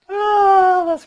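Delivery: high, loud delighted cry. Real, not acted. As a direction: squeal with delight. Delighted laughing reaction.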